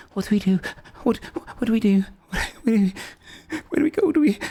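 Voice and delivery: high, frantic panicked questions; panicked Pepper. Acted. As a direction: spiral into panic, voice climbing. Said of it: "she looks and goes".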